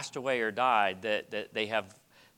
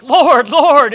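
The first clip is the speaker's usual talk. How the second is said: desperate shout; 11 semitones higher, 17 dB louder than usual. Retold or imitated. Disciples crying out in storm.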